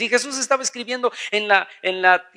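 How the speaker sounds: ordinary talk, nothing marked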